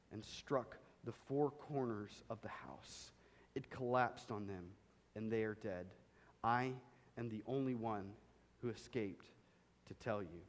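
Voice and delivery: sorrowful hush, trailing away; hushed, grieving report. A narrator's reading. Messenger tells of children's deaths.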